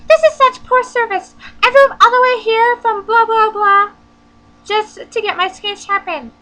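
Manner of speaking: high, loud squawking cartoon shriek; over-the-top cartoon Karen yelling; cartoon angry lady. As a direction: screech it like a raging cartoon customer. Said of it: "It went kinda like this".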